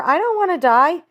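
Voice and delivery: high, loud terrified begging; a pig begging for his life; panicked pleading. A narrator's reading. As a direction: Wilbur cries out for rescue. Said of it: "screamed Wilbur".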